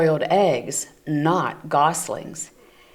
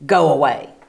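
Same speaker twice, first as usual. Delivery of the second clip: louder stern bear; 4 semitones lower, 6 dB louder than usual. Acted. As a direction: tell them off sternly. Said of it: "Bruce was very stern".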